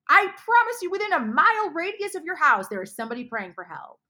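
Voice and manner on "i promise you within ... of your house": higher, louder insistent proclamation; emphatic promise. Real, not acted. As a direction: lift it up and drive the promise home. Preaching climax to listeners.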